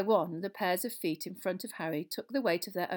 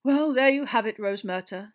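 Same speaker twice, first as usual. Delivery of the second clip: thick-voiced; 4 semitones higher, 12 dB louder than usual. A narrator's reading. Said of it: "said Fudge thickly".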